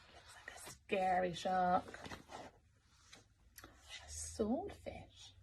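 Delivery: soft whispered aside; hushed whisper. Real, not acted. Reader to child, whispering that it looks scary. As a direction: whisper it as if the shark might hear. Hushed comment after shark.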